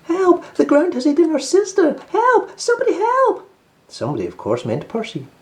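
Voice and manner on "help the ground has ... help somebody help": very high, loud panicked cries; two little rabbits screaming for help; wailing for help. Acted. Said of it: "began to wail".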